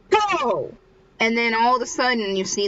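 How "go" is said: high-pitched mild mock yell; mock scream. Someone yelling out of nowhere at basketball, mild mock scream. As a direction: imitate a sudden cry from the court. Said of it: "someone screams".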